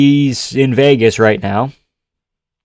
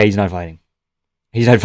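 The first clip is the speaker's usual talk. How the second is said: ordinary delivery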